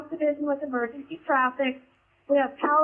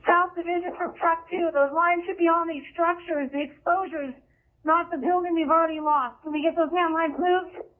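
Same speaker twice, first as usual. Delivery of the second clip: high, urgent radio call; urgent firefighter radio; 10 semitones higher than usual. Real, not acted. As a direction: push it out fast over the radio. Truck officer urging line move.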